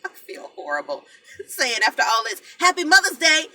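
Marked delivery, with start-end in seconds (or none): 2.6-3.6 loud, high laughing wish. Real, not acted. Wishing viewers happy Mother's Day after crying, laughing loudly. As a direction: burst out laughing at the awkward timing.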